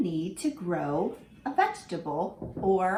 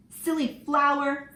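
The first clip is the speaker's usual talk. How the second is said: fed up; 6 semitones higher, 3 dB louder than usual. Acted. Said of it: "Fran got fed up".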